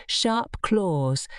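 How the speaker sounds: ordinary delivery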